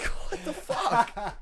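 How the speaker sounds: high, drawn-out squeal through laughter; laughing, re-enacted bewilderment; incredulous. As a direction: squeak it slowly in comic disbelief.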